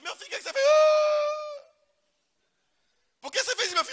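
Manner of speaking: high, loud, pained calls; father crying out to his son, high and loud; anguished father. Retold or imitated. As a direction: plead with the son loudly.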